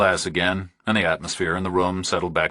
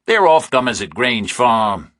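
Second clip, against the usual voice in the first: higher, louder, slower farmer; rural farmer, slow; 5 semitones higher, 5 dB louder than usual. Acted. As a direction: drawl it as local gossip. Narrator voices a rural farmer.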